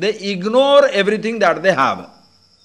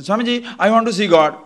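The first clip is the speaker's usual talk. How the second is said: put-on louder, higher child's demand; eager small child; 4 semitones higher, 5 dB louder, faster than usual. Child comes in to ask Swamiji, eager and insistent.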